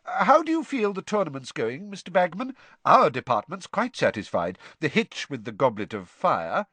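put-on pompous young official, high and eager; Percy voice.

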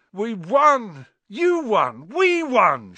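loud, high victory shout. A narrator's reading. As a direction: yell it out in triumph. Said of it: "shouted Ron".

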